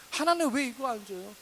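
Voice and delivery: acted demand, high and loud; accusing complaint. Believer demanding things from God, resentful. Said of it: "따지듯 원망하듯".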